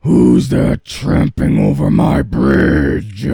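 loud troll roar. Acted. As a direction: roar it out at the big goat. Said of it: "roared the troll".

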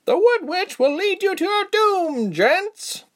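high-pitched woman's cry. A narrator's reading. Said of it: "one woman cried".

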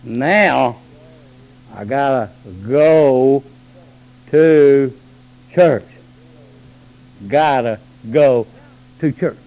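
low, slow reluctant mumble; mock grumble. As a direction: drag the words like a dutiful believer. Mocking reluctant churchgoer.

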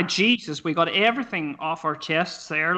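ordinary talk, nothing marked

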